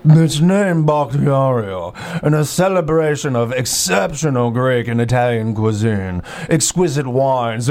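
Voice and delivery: slow, drawn-out put-on voice of indulgence; hammed-up restaurant pitch. Shown as a demonstration. Comic host selling the Greek restaurant, hammed-up luxurious delivery. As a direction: savour every word like a pompous epicure. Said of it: "how you're supposed to deliver".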